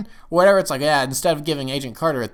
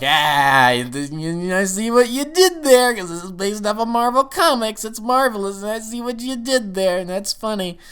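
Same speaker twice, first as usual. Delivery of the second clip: joking "eh" reaction; 10 semitones higher, 4 dB louder than usual. Retold or imitated. Fan reacting to the Marvel pun, mock-knowing delight. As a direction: open loud, then stay high, savouring the joke. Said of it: "that was kind of a, "Eh," moment".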